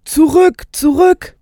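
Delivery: high, loud call to get back; acted cry of command; urgent shouted warning. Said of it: "hörte ich Stans Stimme".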